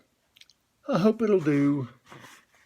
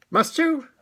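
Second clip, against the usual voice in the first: polite; 11 semitones higher, 4 dB louder than usual. Acted. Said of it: "said Rabbit politely".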